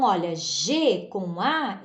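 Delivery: ordinary talk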